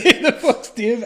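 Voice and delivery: loud, slow, laughing echo of the question; laughing disbelief. Real, not acted. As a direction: repeat it slowly, cracking up. Laughing at the lyric.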